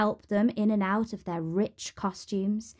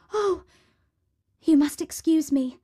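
high, startled exclamation; exclaiming; 6 semitones higher, 4 dB louder than usual. A narrator's reading. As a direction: break away with a sudden high "oh". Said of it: "exclaimed Cinderella".

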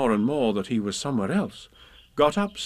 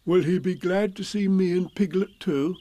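higher, simple questioning voice; innocent Pooh; 7 semitones higher than usual. Acted. Pooh asking Rabbit about himself and Piglet, innocent. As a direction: ask it hopefully.

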